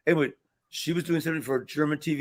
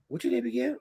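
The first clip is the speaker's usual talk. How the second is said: higher, softer put-on voice of an aloof boss; mock offhand query, pitched up; dismissive; 9 semitones higher, 5 dB quieter than usual. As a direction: imitate someone barely deigning to ask.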